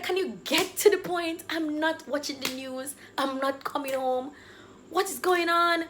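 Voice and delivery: high, impatient retort to mom; exasperated. Retold or imitated. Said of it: "This is me already exasperated".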